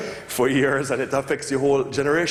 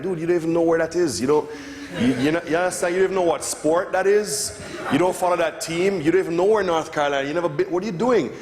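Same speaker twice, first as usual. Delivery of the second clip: raised, incredulous scolding of a fake fan; exasperated scoff; 6 semitones higher than usual. Mocking a Jamaican artist in a Tar Heels cap, incredulous. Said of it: "and you're like".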